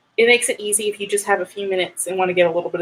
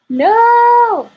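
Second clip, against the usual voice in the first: long, high, loud wail of NO; dismayed cry; 13 semitones higher, 9 dB louder than usual. Real, not acted. Computer paste goes wrong.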